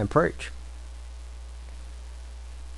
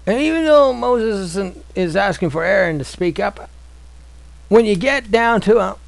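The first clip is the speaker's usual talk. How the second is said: preaching; 6 semitones higher than usual. Acted. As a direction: drive home each question to the flock. Preaching rising to full force.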